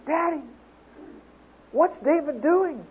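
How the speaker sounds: put-on a small girl near tears asking her daddy; little girl voice. Dad voicing little daughter.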